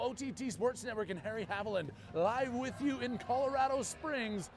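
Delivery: sportscaster billing the final tournament; broadcaster station ident. Real, not acted. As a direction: announce it to the audience with a big sense of occasion.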